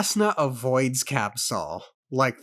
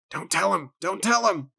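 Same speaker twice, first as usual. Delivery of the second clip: higher, more insistent on the repeat; rising urgency, pitched up; urgent warning; 6 semitones higher than usual. Real, not acted. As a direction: plead with a character not to blab. Reader pleads with the character.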